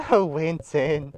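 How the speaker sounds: slow, low, fed-up "Oh, Winton"; exasperated sigh. Acted. Friend sighing at Winton's forgetful story, slow and exasperated. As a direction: drag it out in disbelief. Another character sighs at him.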